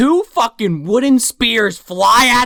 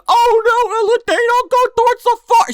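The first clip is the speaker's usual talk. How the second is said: very high, loud cartoon panic; mock alarmed Simon; 4 dB louder than usual. Simon seeing Ella leave, high mock alarm. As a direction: cry out a warning in a hammed-up falsetto. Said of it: "he's like".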